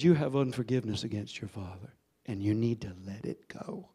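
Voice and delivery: low, hushed divine voice, understated; voice of the Lord. Retold or imitated. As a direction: speak as God would, low and soft. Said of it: "And the Lord said".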